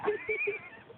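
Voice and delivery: short high-pitched laugh; burst of laughter. Real, not acted. Roaster after own jab, high burst of laughter.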